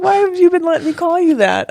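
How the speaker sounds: exasperated disbelief; surprised. Retold or imitated. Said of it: "He's like".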